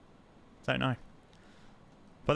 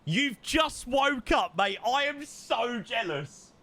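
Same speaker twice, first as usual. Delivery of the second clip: mock outrage; 10 semitones higher, slower than usual. Real, not acted. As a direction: play up the envy, slow and high. Friend just woke, mock outrage.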